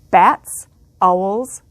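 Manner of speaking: ordinary delivery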